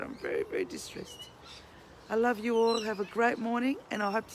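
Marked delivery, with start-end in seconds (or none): 0.0-1.3 breathless, unvoiced, very low whisper; distressed whisper. Real, not acted. Telling viewers she is upset about the ducks, whispered. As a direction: let it fall away into a broken whisper. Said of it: "I'm very sad".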